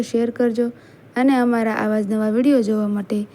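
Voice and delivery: ordinary delivery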